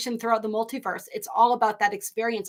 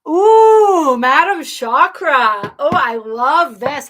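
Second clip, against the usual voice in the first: a louder, slow, drawn-out happy squeal; delighted; 3 semitones higher, 9 dB louder, much slower than usual. Real, not acted. Greeting a favourite viewer's comment, drawn-out and delighted. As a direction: savour the name with real delight.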